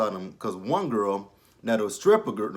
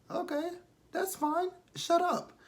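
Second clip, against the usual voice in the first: much higher, sarcastic brush-off; mocking sass; slower than usual. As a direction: pitch way up and wave her off. Mocking a TV character.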